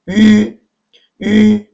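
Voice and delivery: 위 held long on a high, level tone; u-umlaut pronunciation. Shown as a demonstration. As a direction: model 위 as one sustained high vowel. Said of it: "이게 유 땡땡 발음이에요".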